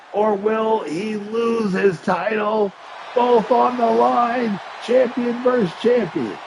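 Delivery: high, slow, booming hype call; excited announcer. Acted. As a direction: stretch each word for the title stakes. High-stakes championship call.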